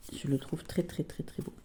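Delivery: breathy whisper of admiration; barely voiced, savouring the words; whispered gushing. Real, not acted. To viewers about her deck, a soft whisper.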